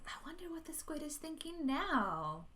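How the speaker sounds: usual speaking voice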